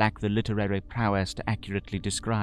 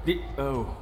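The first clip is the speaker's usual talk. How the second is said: higher, fast flustered gentleman; 8 semitones higher than usual. Acted. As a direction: babble in a panic. Narrator character flustered.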